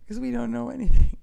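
high, loud laughing line. Real, not acted. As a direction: laugh through the words, real joy. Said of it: "just laughing in the joy".

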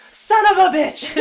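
high, loud startled curse; full-voiced outburst; yelling. A narrator's reading. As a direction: yell out as if caught off guard. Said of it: "Dean yelled".

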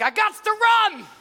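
high, loud mock cry; mock shout. Worshipper crying out in church before running laps, loud. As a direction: shout it like you must run. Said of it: "somebody's like".

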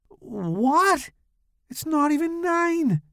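high, indignant whine; protesting Tommy. A narrator's reading. Tommy objects to going home early, high indignant whine.

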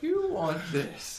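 slow, high, hushed tempting villain; Palpatine impression. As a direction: coax it out like a devilish tempter. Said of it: "We just do a Palpatine impression".

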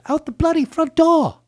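high, drawn-out mock Cockney; Cockney accent. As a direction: put on a big, high British Cockney voice. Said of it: "To say it in British".

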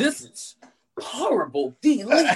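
mock horror. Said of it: "I was like".

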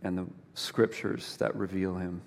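low, soft, unhurried praying voice; prayer. Real, not acted. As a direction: lead a quiet prayer, pausing between phrases. Closing a spoken prayer.

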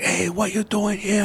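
high, slow mock challenge of an intruder; imitating the angry new owner. New owner confronts them at the old house, high slow imitation. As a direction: play the suspicious homeowner catching strangers on his porch. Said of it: "he's like".

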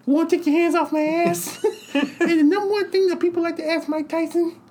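high squeaky lisping Tyson voice, way up; Mike Tyson impression. Tyson doing his monologue to the crowd, in a high squeaky voice. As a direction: mimic Tyson's high voice hard. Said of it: "So it's like".

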